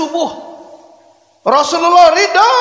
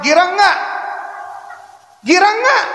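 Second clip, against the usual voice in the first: rising eager question; 6 semitones higher than usual. Real, not acted. Preacher quizzing the mothers, rising excited question. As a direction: quiz the crowd, rising higher each time.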